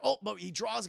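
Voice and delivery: normal talking voice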